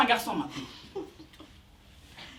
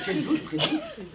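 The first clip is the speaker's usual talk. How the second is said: mock sweet; 13 semitones higher, 5 dB quieter, slower than usual. Flirting with volunteer.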